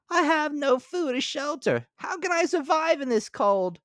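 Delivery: lightly whimpering grasshopper voice. Acted. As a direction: play the freezing grasshopper, a touch pitiful. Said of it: "she whimpered".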